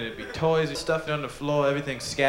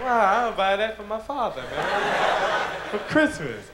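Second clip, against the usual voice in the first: high, excited pitchman voice; hammed-up delighted dad in an ad; mock commercial guy; 8 semitones higher, slower than usual. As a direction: gush like a TV ad actor. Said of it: "He's like".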